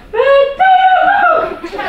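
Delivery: very high, loud, slow shriek with laughter. Acted. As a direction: scream it while laughing. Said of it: "gilde mama".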